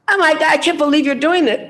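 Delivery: high, shocked indignant voice. Retold or imitated.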